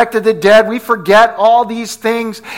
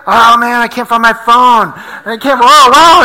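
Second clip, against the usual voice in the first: loud, hammed-up retold panic; mock freak-out; 5 dB louder than usual. Preacher retelling his lost-phone scare to church, mock panic. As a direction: act out freaking out, louder than usual. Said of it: "so I'm freaking out".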